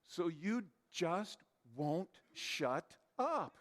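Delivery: word by word, with gaps; slow, deliberate emphasis. Real, not acted. Preacher describing the nonstop verbal processor, slow and deliberate. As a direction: space out every word for comic weight.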